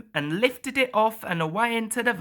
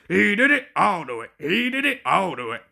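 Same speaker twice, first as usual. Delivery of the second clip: panting chant; faster than usual. A narrator's reading. Gordon pants racing along, puffing. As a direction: puff it like a straining engine. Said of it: "he panted".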